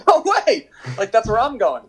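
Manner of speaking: retelling Josie's high, laughing "no way"; excited disbelief. Retold or imitated. Josie learns his new teammate joined the same club, excited and laughing. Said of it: "And he goes".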